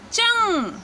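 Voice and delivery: चंग said clearly with the nasal ng; teaching demo. Shown as a demonstration. Teacher showing students how to say चंग. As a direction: model चंग for learners. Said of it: "उच्चारण होगा".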